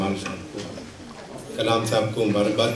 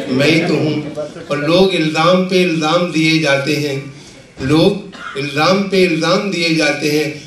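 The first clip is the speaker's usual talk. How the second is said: measured, projected Urdu verse recital; comic poet reciting with rhythmic phrasing; mushaira recitation; 6 semitones higher, 6 dB louder than usual. Acted. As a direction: declaim the couplets to a live audience, line by line.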